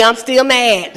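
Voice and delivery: normal talking voice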